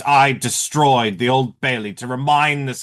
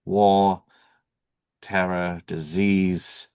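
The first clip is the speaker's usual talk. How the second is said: ordinary talk, nothing marked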